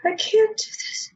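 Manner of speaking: very high, breaking-down plea; high-pitched despairing protest; distressed refusal. Acted. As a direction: squeak it out, overwhelmed. Distressed character says she cannot cope.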